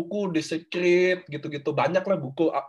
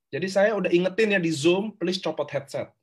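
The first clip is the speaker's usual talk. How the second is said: usual speaking voice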